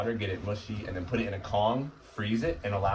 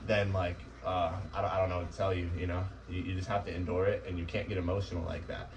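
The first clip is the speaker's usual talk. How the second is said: ordinary delivery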